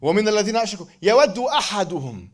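fast, chanted Arabic verse; recited verse rising into melody; Quran recitation. Acted.

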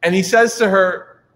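ordinary talk

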